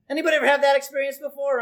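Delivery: ordinary delivery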